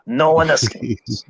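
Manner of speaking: slow ominous mock.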